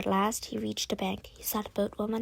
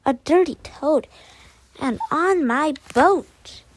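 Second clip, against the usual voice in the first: furious boatwoman; 5 semitones higher, 8 dB louder, slower than usual. A narrator's reading. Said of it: "she cried".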